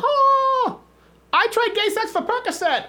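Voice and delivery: very high screechy impression of a screamer; mock screaming. Showing the guest how to act the airline passenger, high mock scream. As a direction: wail it way up high, hammed-up.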